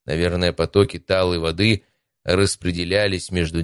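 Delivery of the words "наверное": plain reading voice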